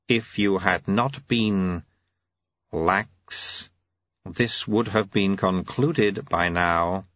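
slow, measured auditor's rebuke; ponderous, deliberate official. Acted. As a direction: space it out with formal disapproval.

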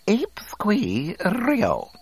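very high, slow droid chirping; civil alien speech. A narrator's reading. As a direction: squeak out the alien tongue politely. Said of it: "he said with much civility".

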